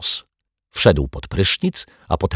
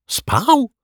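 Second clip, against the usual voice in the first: very high, stunned one-word question; dumbfounded, pitch shooting up; stupefied splutter. A narrator's reading. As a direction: squeak it out in disbelief. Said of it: "wykrztusił osłupiały detektyw".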